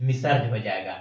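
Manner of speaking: ordinary talk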